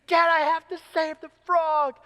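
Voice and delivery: high-pitched, whining imitation of a child; hammed-up desperate little girl begging; desperate child's plea. Daughter begging dad a second time, desperate. Retold child pleads again, escalating.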